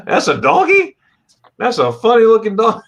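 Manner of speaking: amused disbelief at a funny donkey; surprised. Real, not acted. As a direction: react in disbelief at the photo. Reacting to a donkey picture.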